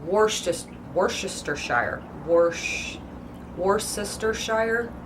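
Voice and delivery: slow, halting Worcestershire, sounded out; Worcestershire with a full shire ending; as written. Shown as a demonstration. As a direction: puzzle through Worcestershire syllable by syllable. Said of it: "strictly how it's written".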